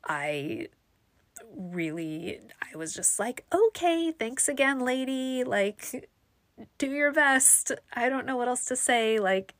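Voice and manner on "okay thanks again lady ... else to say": higher, politely unassertive sign-off; retold polite giving-up; cordial. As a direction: let them off nicely, pitched up. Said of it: "cordial person. I'm not great at being assertive".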